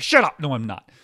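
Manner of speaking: sudden high, loud retort; indignant shout. Acted. Arguing back at a taunting inner voice, indignant shout. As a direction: yell it back in protest.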